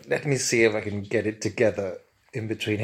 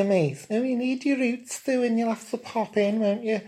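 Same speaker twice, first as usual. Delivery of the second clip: much higher, gushing camp Scouse; camp Liverpool accent; 12 semitones higher than usual. Acted. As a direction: fuss over his roots in camp Liverpool. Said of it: "in his camp Liverpool accent".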